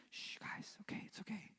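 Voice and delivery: whispered shushing; hushed whisper. Retold or imitated. Teacher whispering to calm the class. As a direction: hush the kids in a whisper. Teacher hushing kids before principal.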